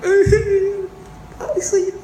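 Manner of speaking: high, crying cry to the Lord; mock wail. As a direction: wail it like a prisoner in the dungeon. Acting prisoners crying out.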